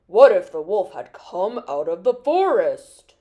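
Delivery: deep, booming scolding; angry grandfather. A narrator's reading. Grandfather warns Peter about the wolf, angry deep voice. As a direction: scold as a stern grandfather, low and loud. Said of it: "He was angry with Peter".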